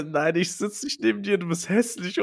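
put-on high, snotty kid voice; lightly acted playground taunt; mock childish taunt. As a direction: sneer it like a mean first-grader. Kid taunting classmate, retold.

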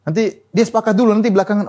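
fervent preaching. Acted. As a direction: drive the point home to the women. Preaching at full force.

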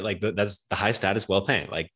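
usual speaking voice

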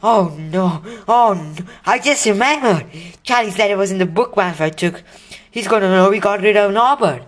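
high, rushing panic; blurting out a sudden dreadful realization; panicked. Acted. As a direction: Ron suddenly remembering the letter Malfoy took, panicked. Ron panics about the letter.

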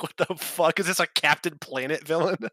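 ordinary talk, nothing marked

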